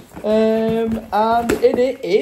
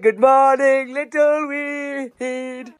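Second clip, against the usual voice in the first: higher, drawn-out sing-song, slow on little weed; playful repeat of the greeting, higher and slower; 6 semitones higher, much slower than usual. Shown as a demonstration. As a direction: stretch little weed out slowly in a singsong. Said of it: "I said".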